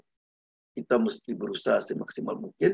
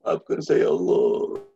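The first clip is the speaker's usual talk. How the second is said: mild acted weeping prayer, sinking at the end; mock tearful plea; 5 semitones lower, slower than usual. Tha'labah begging God on the hill, imitated tearful plea. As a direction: plead for forgiveness, lightly tearful. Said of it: "habis asar lagi nangis".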